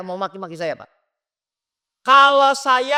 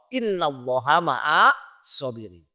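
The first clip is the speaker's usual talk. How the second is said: Quran recitation; 7 semitones lower, 4 dB quieter, slower than usual. Acted. Preacher recites Arabic verse.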